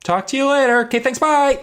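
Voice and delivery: high, loud chirpy goodbye to hang up fast; hasty brush-off. Retold or imitated. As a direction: sing out a quick fake-cheerful bye. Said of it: "Sam's like".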